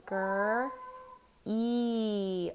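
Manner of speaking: green stretched out as "Gr-" then a long "E"; stretched out sounds. Shown as a demonstration. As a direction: sound out Gr- E slowly, sound by sound. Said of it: "Stretch out green with me".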